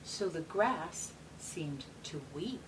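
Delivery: gentle storybook reading; reading to children. Acted. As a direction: read it like a bedtime picture book. Reads picture book aloud.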